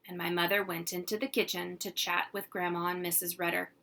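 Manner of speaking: ordinary delivery, nothing marked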